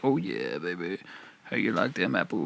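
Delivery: low, soft, smug crooning; low seductive voice. Pretend. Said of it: "it's gonna be like".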